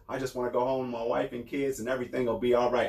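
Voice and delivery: low, soft-spoken mock family man; mocking imitation. Host mimicking people who stay out of it, mocking. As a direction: drop low and quiet, mimicking the uninvolved.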